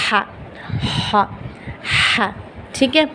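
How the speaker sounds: ہا with an airy throat h; soft Arabic ḥā in ہا; breathy ha. Shown as a demonstration. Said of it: "same ایسی".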